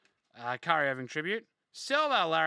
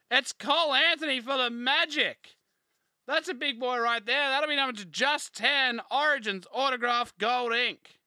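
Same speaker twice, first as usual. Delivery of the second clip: higher and louder, buzzing with excitement; hyped-up reveal; 7 semitones higher, 6 dB louder than usual. Real, not acted. Pack opener hyping a numbered autograph card to viewers, excited. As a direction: shout out the big pull like you can't believe it.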